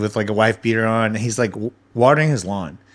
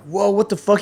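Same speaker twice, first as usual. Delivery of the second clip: high, startled outcry; startled whoa; 9 semitones higher than usual. Retold or imitated. Startled at his opponent yanked away, high outcry. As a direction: jolt up as the body flies off.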